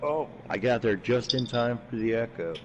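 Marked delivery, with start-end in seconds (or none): none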